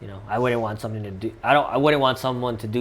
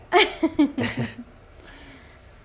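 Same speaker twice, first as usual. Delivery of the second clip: big laugh; 6 dB louder than usual. Real, not acted. Laughing at a shared joke.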